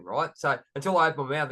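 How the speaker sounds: ordinary talk, nothing marked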